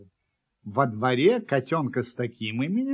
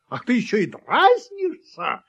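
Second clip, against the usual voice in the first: loud, raised-pitch indignant cry; angry shout; 6 semitones higher, 7 dB louder than usual. A narrator's reading. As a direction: shout as the offended dog. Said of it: "закричала собака". (возмущённый крик собаки)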